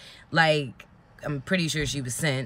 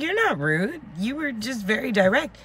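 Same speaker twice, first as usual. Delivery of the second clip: put-on higher, reassuring older lady voice; higher mock voice of a kind stranger; imitating older woman; 7 semitones higher than usual. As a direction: put on the kind customer, pitched up. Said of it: "She was like".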